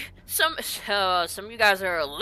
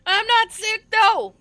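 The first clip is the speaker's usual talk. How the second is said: higher, louder insistence; indignant protest; 9 semitones higher, 8 dB louder, slower than usual. Acted. Wizard protests to the scholar, higher and louder. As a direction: push back hard on the diagnosis.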